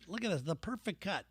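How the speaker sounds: usual speaking voice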